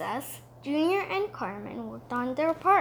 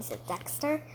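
ordinary delivery

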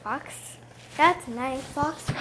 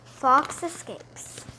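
plain reading voice

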